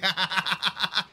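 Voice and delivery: giggle. Real, not acted. Laughs at death.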